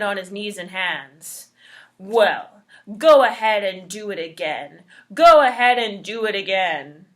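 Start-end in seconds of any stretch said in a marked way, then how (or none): 2.0-7.1 loud taunting dare; defiant taunting. Acted. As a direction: taunt it to go again. Trickster dares his wind.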